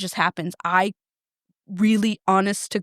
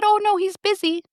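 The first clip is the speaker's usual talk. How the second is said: breezy receptionist; 11 semitones higher than usual. Retold or imitated. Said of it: "And she said".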